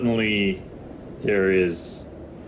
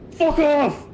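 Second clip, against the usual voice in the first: much higher, louder shout; shouted insult; 4 dB louder than usual. Acted. Dahlin yelling at the rock monster he just smashed. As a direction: bark it down at the beaten monster. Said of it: "he looks down at it and he goes".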